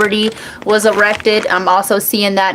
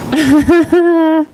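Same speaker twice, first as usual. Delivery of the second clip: laughing; 9 semitones higher, 3 dB louder than usual. Real, not acted. Laughing fit.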